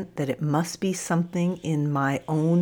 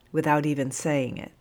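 usual speaking voice

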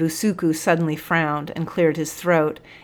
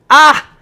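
single high, very loud cry; 10 semitones higher, 15 dB louder than usual. A narrator's reading. Busuku reacting to the accusation, one loud cry. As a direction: let out one sudden high shout. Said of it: "let out one loud".

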